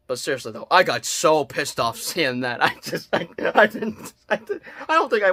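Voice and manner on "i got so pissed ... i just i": usual speaking voice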